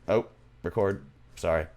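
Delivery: ordinary talk, nothing marked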